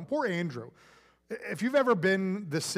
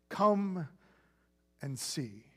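Jesus inviting them into his life, tenderly; tender invitation; 2 semitones lower, much slower than usual. Retold or imitated. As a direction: let "and see" fall to a near hush.

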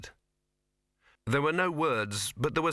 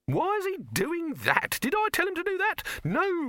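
high-pitched, incredulous squawk; indignant Silverfish. A narrator's reading. Silverfish protesting at what the actor does, high and indignant. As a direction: complain in a high, put-on voice.

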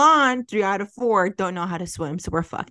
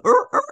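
mimicked dog whine; 7 dB louder than usual. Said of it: "I heard, like".

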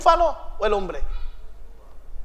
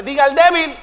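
ordinary talk, nothing marked

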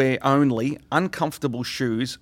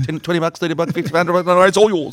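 higher, building louder as the offers grow; mock doting dad; 6 semitones higher, 6 dB louder, faster than usual. Teasing a dad giving in to his child, mock doting. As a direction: cave in completely, pitch and volume climbing. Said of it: "you're gonna go, What do you want, sweetheart".

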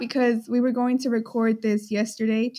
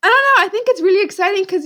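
excited; 8 semitones higher, 6 dB louder, much faster than usual. Real, not acted. Excited about the new microphone.

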